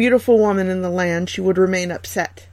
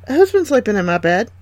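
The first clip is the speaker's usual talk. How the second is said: ordinary delivery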